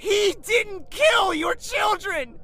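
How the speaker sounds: high, loud, slow shouted denial; enraged shout. Acted. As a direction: scream the denial. Prince defends his father.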